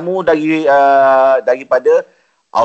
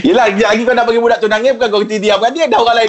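laughing; 6 semitones higher, faster than usual. Real, not acted.

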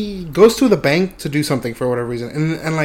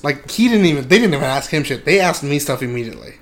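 ordinary talk, nothing marked

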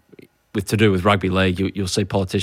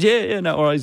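higher, jovial brush-off; breezy politician's reassurance; mock-jovial prime minister; 8 semitones higher than usual. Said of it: "He's all jovial".

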